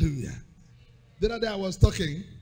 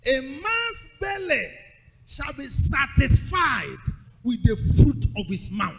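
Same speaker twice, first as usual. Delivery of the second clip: slow solemn scripture reading; slow reading of Proverbs; scripture read aloud; 2 semitones higher, 5 dB louder than usual. Acted. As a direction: intone the verse slowly.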